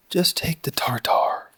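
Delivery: breathy whisper, slow and hushed. Real, not acted. Pitmaster whispering to himself while trimming brisket. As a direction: murmur it to yourself over the meat.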